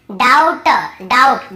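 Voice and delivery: "डाउट" as dout, no B; silent B. Shown as a demonstration. Teacher shows students how to read doubt, "डाउट" with B silent. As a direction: go straight from vowel to T in "डाउट". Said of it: "नहीं पढ़ेंगे".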